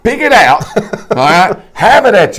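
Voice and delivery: laughing as he hands out the tip. Real, not acted. Laughing while giving the advice.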